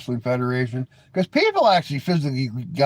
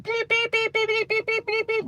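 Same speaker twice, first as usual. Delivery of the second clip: very high, steady electronic beeping; rapid squeaky beeps like a censor button; imitated censor beeps. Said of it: "where I can just be like".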